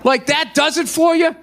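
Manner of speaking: judge them with mock disbelief; incredulous judging. Comedian judging the singing soccer moms, high incredulous jab. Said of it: "Just to be like".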